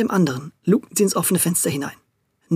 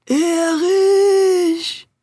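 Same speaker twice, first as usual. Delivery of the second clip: high, louder, long eerie wail; ghost voice; 12 semitones higher, 4 dB louder than usual. Acted. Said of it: "wie mit einer Geisterstimme".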